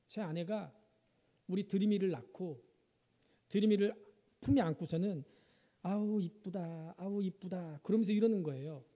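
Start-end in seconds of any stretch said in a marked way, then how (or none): 5.8-7.8 put-on slow, soft cooing over the baby; mother cooing at her baby, slow and soft. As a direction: coo tenderly at a newborn.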